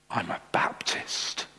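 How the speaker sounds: slow, near-whispered confession; hushed, drawn-out acted reply; hushed admission. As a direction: let it out very softly, haltingly. Jumper's hesitant, hushed admission.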